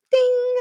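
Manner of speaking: high sung ding; a bright bell ding sung in voice; mock bell ding. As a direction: play the correct-answer chime. Sound effect bell.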